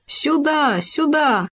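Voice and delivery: high call; крик. A narrator's reading. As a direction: call out to a friend. Hedgehog calls gopher twice. (громкий зов)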